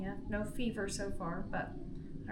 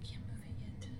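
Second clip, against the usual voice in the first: weak whisper; 8 dB quieter than usual. Real, not acted. Patient whispering in pain.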